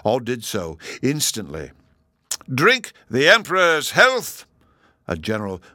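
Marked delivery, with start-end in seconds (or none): 2.5-4.5 loud, high, drawn-out toast; ceremonial shout. A narrator's reading. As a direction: proclaim the toast slowly to the hall. Chancellor thunders toast to hall.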